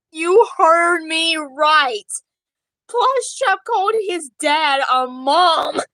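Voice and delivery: loud, drawn-out announcement; emphatic reveal. Pretend. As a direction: proclaim it loudly, word by word. Mock-shocked reveal to the audience.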